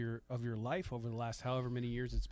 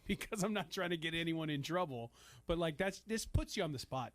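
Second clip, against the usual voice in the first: ordinary talk, nothing marked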